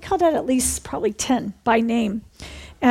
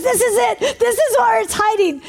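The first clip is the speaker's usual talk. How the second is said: excited discovery; 9 semitones higher than usual. Retold or imitated. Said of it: "Guy goes".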